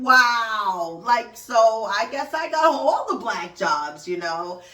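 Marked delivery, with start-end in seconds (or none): 0.0-1.0 louder, drawn-out disbelieving wow; stunned wow. Retold or imitated. Host reacting to her guest, stunned wow. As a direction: let it land in disbelief. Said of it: "I was like".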